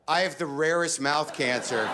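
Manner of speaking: proud self-pity. Retold or imitated. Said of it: "I chose this way".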